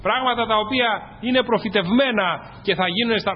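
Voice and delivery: ordinary delivery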